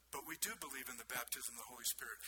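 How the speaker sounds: soft, falling statement with weight on "do"; quietly insist, without pushing; mild assertion. Retold or imitated. Pastor answering a Pentecostal lady, modestly affirming. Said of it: "all I said was".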